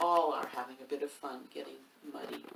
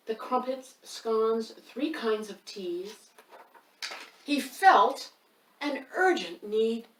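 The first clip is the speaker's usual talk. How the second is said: building dramatic read; 5 semitones higher, 11 dB louder, slower than usual. A narrator's reading. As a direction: build the tension toward the sneeze. Reads picture book aloud.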